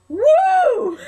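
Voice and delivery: high, loud "woo" breaking into laughter; excited whoop. Real, not acted. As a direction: whoop in triumph, then laugh. Celebrating a favourite wrestler's win.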